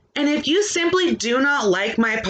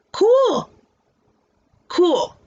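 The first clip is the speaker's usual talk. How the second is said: sarcastic loud cool; 6 semitones higher, 4 dB louder than usual. Real, not acted. Dismissing critics sarcastically.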